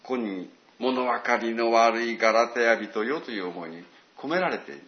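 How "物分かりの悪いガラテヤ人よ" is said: slow, gentle fond rebuke; loving reproach. Retold or imitated. Paul to the Galatians as to his children, tender reproach. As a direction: chide like a loving parent. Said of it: "親が子供に対して大きな愛を持って".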